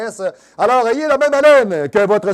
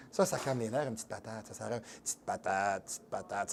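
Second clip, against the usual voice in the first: presque chuchoté, beaucoup plus bas et grave, comme une berceuse moqueuse; doux moqueur; 10 semitones lower, 20 dB quieter than usual. Pretend. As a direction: murmurer « petite patate » comme pour endormir quelqu'un. Moque ceux qui vantent la patate. (il se moque du conseil de manger une patate en murmurant tendrement)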